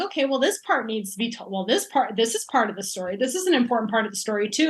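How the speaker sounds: fast, tumbling thoughts; rushed inner monologue. Retold or imitated. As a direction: rattle it off quickly. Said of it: "I'm like".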